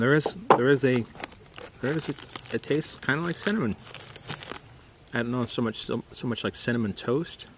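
chewing between words; talking while chewing. Real, not acted. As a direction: mouth partly full. Seen 1: Lips pressed and working between words; orange wafer bar in hand.

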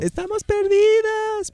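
muy aguda y lenta, cantada; voz de video viral. Retold or imitated. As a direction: imitando la voz aguda y estirada del video. Imita video Estamos Perdidas. (voz aguda y arrastrada imitando el video)

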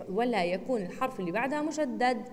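usual speaking voice

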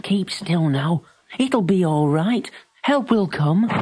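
calm the frightened pony; soothing. A narrator's reading. Billy soothing his trapped pony, slow and gentle.

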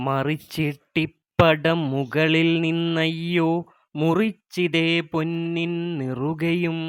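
slow, even recital of the verse; poem recitation. Acted. Teacher reciting Asan's poem to her students, measured recital. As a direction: read each line as a measured poem.

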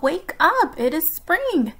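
shouting. A narrator's reading. Frog urges Toad to get up, shouting. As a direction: call out brightly to rouse a sleeper. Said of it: "shouted Frog".

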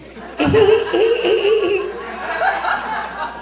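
very high drawn-out giggle. Retold or imitated. Impression ending in Tyson's giggle, very high giggle. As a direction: let out a long squeaky giggle.

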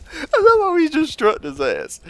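high, loud, tickled delivery; amused squeal. Real, not acted. Player amused by an enemy's strut, amused squeal. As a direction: squeal it out on the tail of a laugh.

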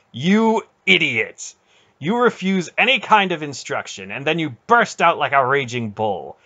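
high, loud angry dressing-down; furious scolding. A narrator's reading. Aunt turning on Garion, furious scolding. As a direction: berate him at full voice. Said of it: "her eyes blazing".

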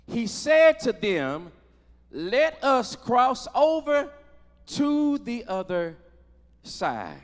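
slow, deliberate Bible reading; stately pulpit reading, dropping on the final word; scripture reading. Real, not acted. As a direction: measure out each phrase with solemn pauses. Reads scripture to congregation.

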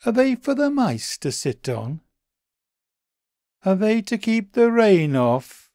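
high, small puzzled bunny voice; a high, wondering little creature; Daily B's voice. A narrator's reading.

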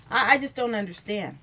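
ordinary talk, nothing marked

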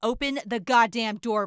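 furious shout through a locked door; angry yelling. Acted. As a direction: bang on the door and yell. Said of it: "when I was yelling at Raccoon Eyes".

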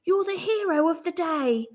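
high, animated praise; cheering. A narrator's reading. Team hails its goal scorer, cheering. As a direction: cheer on the hero. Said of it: "His team cheered".

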